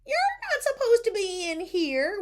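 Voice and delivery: high, loud scolding of an intruder; yelling lunch lady. A narrator's reading. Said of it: "one of the lunch ladies yelled".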